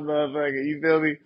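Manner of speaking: ordinary talk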